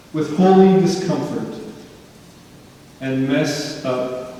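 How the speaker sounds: slow, deliberate sermon opening with long pauses; measured preaching. Real, not acted. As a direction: preach it phrase by phrase, letting each one land. Opens the sermon proper.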